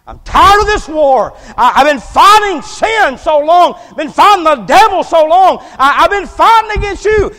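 high, full-force preacher's shout; roaring, high-pitched confession of a weary fighter; worn-out sinner's cry. Acted. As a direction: cry out a surrender to God at the top of your voice. Acted sinner surrendering to the Lord.

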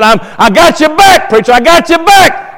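high, emphatic pledge of loyalty; speaking up boldly. Retold or imitated. Church man pledging support to the preacher, boldly speaking up. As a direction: imitate an eager supporter calling out, pitched well up. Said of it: "always spoke up and said".

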